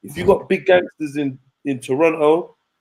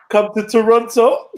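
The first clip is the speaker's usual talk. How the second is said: slow, higher, laughing mock of a boast; mocking the chat; 7 semitones higher, slower than usual. Streamer laughing as he reads a chat boast, mocking. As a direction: laugh it off while quoting the chat. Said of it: "Man said, yeah".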